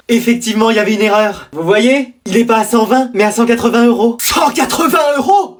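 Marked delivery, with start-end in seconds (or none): 4.2-5.6 slow, higher, shouted disbelief; outraged price shock. Acted. Mother stunned by the shop assistant's price, shouting slowly.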